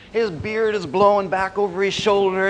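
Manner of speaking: ordinary delivery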